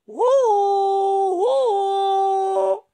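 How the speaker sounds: long high loud double "uh"; echoed hoot. Acted. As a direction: send the owl's cry back as an echo. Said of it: "E il posto di rimando". (eco lunga e acuta)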